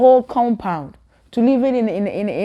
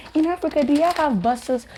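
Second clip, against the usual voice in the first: naive asker; 9 semitones higher than usual. Retold or imitated. Classmate asking about buses in Africa, high naive voice. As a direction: ask it with wide-eyed ignorance. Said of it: "somebody said".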